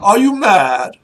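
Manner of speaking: high-pitched aristocratic outrage; affronted, pompous disbelief; incredulous noble. Acted. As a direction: challenge a stranger's sanity like a lord. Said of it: "It's like".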